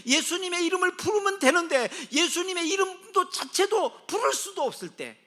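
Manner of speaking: loud, high, mounting appeal; full-force preaching. Acted. Preacher asking who helps when prayer fails, full-force fervour. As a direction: cry out each line to the congregation.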